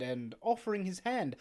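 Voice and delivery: usual speaking voice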